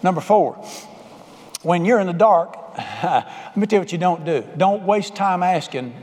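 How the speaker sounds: ordinary talk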